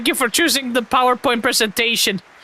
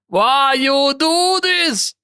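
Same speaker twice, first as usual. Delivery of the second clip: mock betrayal; much slower than usual. Complaining to idle teammate.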